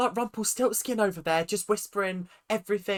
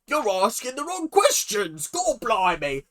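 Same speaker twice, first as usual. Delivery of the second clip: high-pitched hammed-up British accent; exaggerated British indignation; mock British accent; 4 semitones higher than usual. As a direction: parody the TV Brit with an overdone accent. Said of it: "their depiction of British people is wholly inaccurate".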